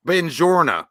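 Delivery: Southern drawl mangling Italian; Brad Pitt's bad Italian. Imitating Brad Pitt's bad Italian to his friend. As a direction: say buongiorno like a clueless Texan. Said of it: "Brad Pitt in Inglourious Basterds".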